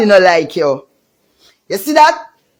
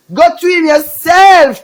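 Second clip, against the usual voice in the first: loud taunt; 7 semitones higher, 5 dB louder than usual. Real, not acted. Taunting a rival in a rant.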